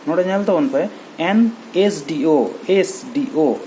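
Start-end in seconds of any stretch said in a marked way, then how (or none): 1.2-3.6 ᱮᱥ ᱰᱤ ᱳ spelt slowly letter by letter; spelling out letters. Shown as a demonstration. Teacher spelling ᱮᱥ ᱰᱤ ᱳ for students, letter by letter. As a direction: sound out ᱮᱥ ᱰᱤ ᱳ one letter at a time. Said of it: "ᱮᱥ ᱮ ᱦᱤᱡᱩᱜ ᱠᱟᱱᱟ".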